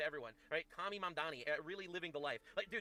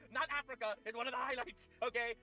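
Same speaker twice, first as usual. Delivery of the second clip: angry rant; 6 semitones higher than usual. Real, not acted. Ranting back at clip speaker.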